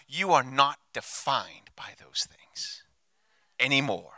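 hushed, slow whisper; soft unvoiced reassurance. Real, not acted. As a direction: lean in and breathe the words. Pastor reassures congregation softly.

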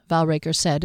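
plain reading voice

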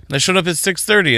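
ordinary delivery

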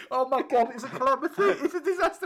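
high, loud comic alarm; mock panic. Real, not acted. Host to co-host over a missing number, loud mock panic. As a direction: wail it as a hammed-up catastrophe.

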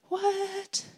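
high, soft, drawn-out what; acted disbelief. Woman reading a stranger's offer of prayer, high acted disbelief. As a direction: replay stunned disbelief in a high voice.

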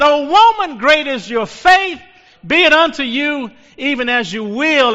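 high, loud, ringing proclamation; preacher voicing Jesus's blessing; Jesus speaking in the sermon. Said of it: "And he said".

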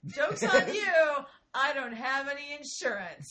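a gleeful told-you-so to the hospital staff; gloating retort. Retold or imitated. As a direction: shout it at them like a win. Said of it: "I said".

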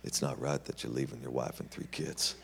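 soft, low and serious; quiet rebuke. Retold or imitated. Tony quietly confronting the hitchhiker in the car. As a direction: lay it on him gently.

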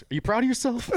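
very high, loud mock taunt; mocking echo. Comic to the fallen heckler, mocking. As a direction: throw the heckler's question back, high and loud.

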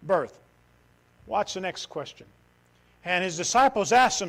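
usual speaking voice

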